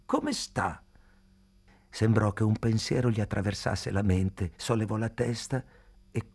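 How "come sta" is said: high-pitched, falling greeting; toothless whistling greeting. A narrator's reading. Old man repeating his greeting to May, high and whistling. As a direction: ask it high, as through missing teeth. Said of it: "con un sibilo della lingua fra i buchi".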